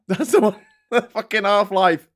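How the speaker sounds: real laugh breaking into the words. Real, not acted. Streamer amused at finally finding the word, laughing.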